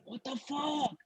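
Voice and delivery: high, hushed disbelief; stunned onlooker. Retold or imitated. Friend at back of room watching, hushed stunned disbelief. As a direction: imitate an aghast friend under his breath.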